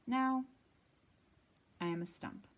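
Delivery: plain reading voice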